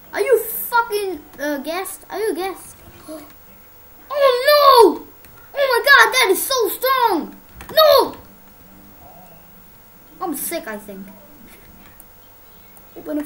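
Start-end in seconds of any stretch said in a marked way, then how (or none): 4.2-8.2 loud, high frightened cries; startled gamer screaming at the screen; real panicked yelling. Real, not acted. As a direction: yell in genuine alarm. Startled in game.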